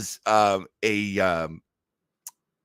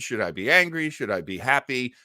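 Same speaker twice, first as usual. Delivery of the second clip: normal talking voice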